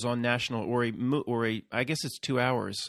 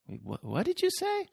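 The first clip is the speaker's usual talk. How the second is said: mock confusion.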